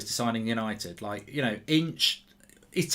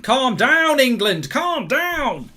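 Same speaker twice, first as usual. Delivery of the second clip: pleading shout; 8 semitones higher, 5 dB louder, much slower than usual. Real, not acted. Pleading with team during chance.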